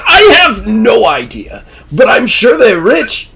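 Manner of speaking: high, loud, slow cheerful shout; showman host. Acted. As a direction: beam it out gleefully. Zazelworth answers cheerfully.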